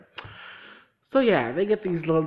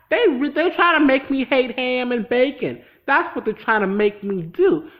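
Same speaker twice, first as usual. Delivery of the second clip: loud, higher indignant complaint; fired-up rant about hating ham; 6 semitones higher, 8 dB louder than usual. Real, not acted. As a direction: protest loudly at the show. Ranting about the show's pigs.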